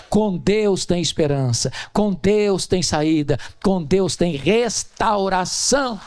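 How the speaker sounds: slow, weighted declaration; solemn sermon refrain, slowed; slow emphatic refrain. Acted. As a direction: land each Deus line deliberately. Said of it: "sermon refrain, slowed".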